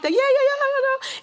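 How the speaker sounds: very high, rapid string of yeahs; happy, high-pitched cheering; excited. Retold or imitated. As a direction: squeal with giddy joy. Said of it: "We all excited, and everybody happy".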